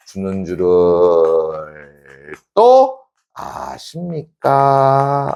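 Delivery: extremely slow, long held syllables; drawn-out reading. Shown as a demonstration. Teacher reads the closing poem line to class, drawn out. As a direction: hold each word like a slow chant.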